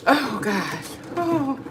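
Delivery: normal talking voice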